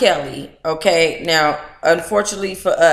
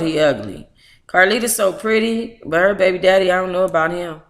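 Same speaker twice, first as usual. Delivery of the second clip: ordinary talk, nothing marked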